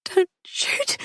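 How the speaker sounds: high breathless plea; Polly begging Patrick on her knees; frightened plea. Acted. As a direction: beg him not to fire. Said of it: "she said to him".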